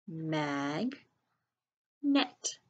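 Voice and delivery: teacher stretching "Mag", then a crisp "net"; syllable by syllable. Shown as a demonstration. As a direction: sound out "Mag, net" with a long gap. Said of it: "sound it out by syllables".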